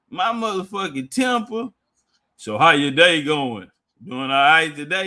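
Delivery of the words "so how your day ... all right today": lower, louder masseur's sing-song greeting; masseuse voice. Retold or imitated. Massage worker greeting a startled customer, lower and louder. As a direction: greet him like the massage lady.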